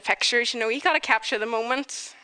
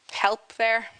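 ordinary talk

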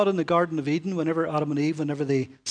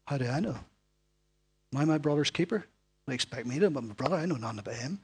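evasive Cain; 5 semitones lower, 4 dB quieter, faster than usual. Acted. Cain answering God evasively.

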